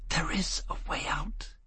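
a disembodied whisper in his ear; whisper from nowhere. A narrator's reading. Unseen angel whispering escape to Foyle, soft whisper. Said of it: "It whispered in his ear from nowhere".

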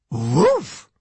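a friendly, rising woof; happy dog bark. A narrator's reading. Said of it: "Missus O'Leary said happily".

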